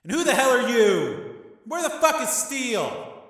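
high, loud demand; furious shout. Acted. Gangster demands to know who's speaking, furious shout. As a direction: yell down at a stranger in outrage. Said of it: "Tommy shouted".

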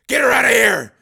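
high, very loud order; urgent shout. Acted. Father hands off wounded daughter, urgent shout. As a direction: bellow it over gunfire.

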